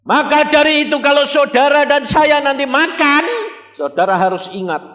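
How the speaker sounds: full-force preaching. Acted. Preacher proclaiming to the congregation, high and loud. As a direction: proclaim it from the pulpit.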